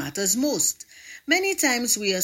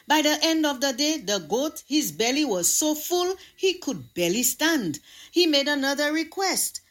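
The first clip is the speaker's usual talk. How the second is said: ordinary delivery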